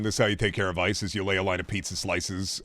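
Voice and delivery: ordinary delivery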